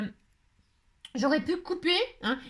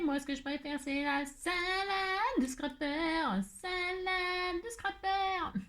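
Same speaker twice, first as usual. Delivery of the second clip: witch voice; 7 semitones higher, 6 dB quieter, slower than usual. Pretend. Witch savours her favourite dish.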